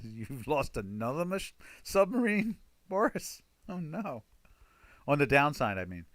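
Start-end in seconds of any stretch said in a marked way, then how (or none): 0.0-4.2 high-pitched mock-incredulous film impression; movie national security advisor. Security advisor questioning the Russian ambassador, mock disbelief. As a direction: imitate a film official's disbelief, pitch jumping up. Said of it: "talking with the national security advisor".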